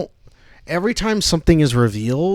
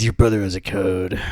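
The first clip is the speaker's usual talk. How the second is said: brooding fake-deep mutter; 7 semitones lower than usual. Quoting movie in deep voice.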